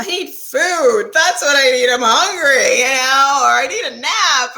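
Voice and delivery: playful whiny answer. Retold or imitated. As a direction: whine like a hungry kid, half-joking. Playful answer to magic question.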